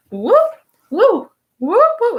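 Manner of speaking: loud, high rising whoops; imitated whoops. Reader imitating the man throwing it away, high whoops. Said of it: "And he's like".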